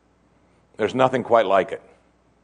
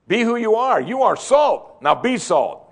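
preaching exhortation; 8 semitones higher, 3 dB louder, slower than usual. Acted. Climactic exhortation.

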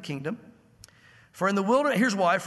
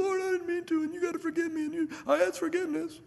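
higher, pleading voice of a contrite Moses; high, plaintive repentant plea; 10 semitones higher than usual. Acted. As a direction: beg forgiveness as a broken old man. Moses voiced begging forgiveness.

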